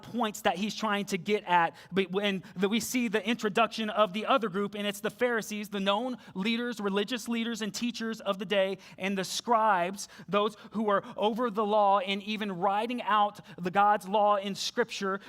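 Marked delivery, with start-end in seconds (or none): none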